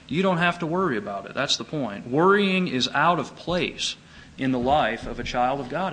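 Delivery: ordinary talk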